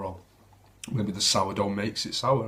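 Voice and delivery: ordinary talk, nothing marked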